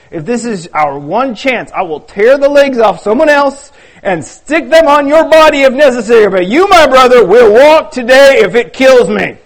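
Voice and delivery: fierce vow. Acted. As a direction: promise it like a fisherman who won't let go. Judah vows, lifting his friend.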